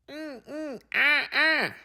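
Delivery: high little monkey grunts; eager high-pitched monkey calls; monkey chatter. Acted. George the monkey wants to help, monkey chatter.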